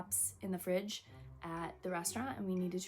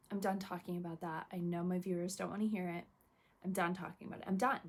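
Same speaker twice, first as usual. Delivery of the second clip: ordinary delivery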